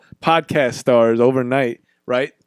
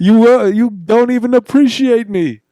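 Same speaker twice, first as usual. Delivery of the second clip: high, loud mock outrage; mock indignant; 7 semitones higher, 4 dB louder than usual. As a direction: scold the crowd in fake outrage. Acting out award rejection.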